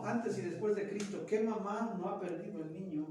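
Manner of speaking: usual speaking voice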